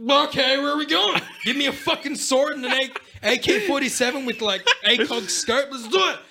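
high, loud, laughing eager yes; hammed-up drunk volunteer grabbing a sword; eager mock volunteer. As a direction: jump at the crusade with hammed-up glee. Himself eager for crusade.

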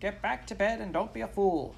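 high-pitched nagging wife; scolding wife. A narrator's reading. Wife sending farmer back to bed, scolding. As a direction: order him about in a high voice.

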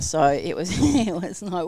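ordinary talk, nothing marked

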